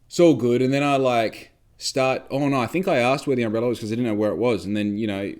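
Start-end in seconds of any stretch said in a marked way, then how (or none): none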